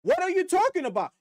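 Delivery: incredulous. Real, not acted. Incredulous rant at critic.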